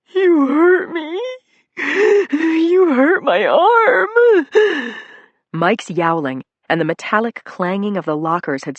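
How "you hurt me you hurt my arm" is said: very high, loud, drawn-out sobbing wail; wailing cry. Acted. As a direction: howl it like a hurt child. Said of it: "Mikey cried".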